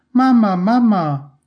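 loud, high, drawn-out calling. A narrator's reading. Little daughter calls to her dozing mother, loud call. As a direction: call out high and slow for Mama. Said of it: "ertönt es laut".

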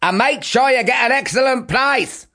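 high, loud call after him; shouting mother. Acted. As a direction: yell the order after Jack. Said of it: "shouted Lily".

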